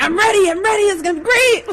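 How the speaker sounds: high, hyped-up student imitation; pumped-up chant, voice jumping high; so excited. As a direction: gush with hammed-up eagerness. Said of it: "he's so excited".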